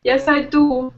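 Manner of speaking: slow, mock German accent, standing your ground; bad German accent. Shown as a demonstration. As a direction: insist in a put-on German accent. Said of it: "switch into really bad German".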